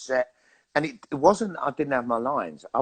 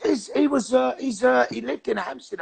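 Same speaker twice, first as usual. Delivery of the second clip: sudden high voice; 11 semitones higher than usual. Real, not acted. Pitch jump while answering.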